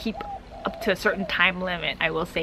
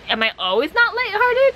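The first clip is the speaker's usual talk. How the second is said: mock incredulous; 14 semitones higher, 5 dB louder than usual. Vlogger answering advice to be more lighthearted, mock incredulous. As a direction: voice shooting up high and loud.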